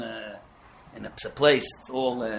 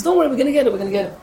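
reassuring driver; 9 semitones higher, 6 dB louder, much faster than usual. Retold or imitated.

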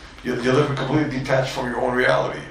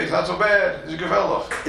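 mock soothing parent; 9 semitones higher than usual. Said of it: "and you say".

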